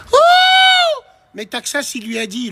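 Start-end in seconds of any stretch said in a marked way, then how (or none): 0.1-1.0 very high falsetto hoot; mock "ooh". Reacting to demand for twenty thousand euros, mock high hoot. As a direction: whoop up high in mock shock.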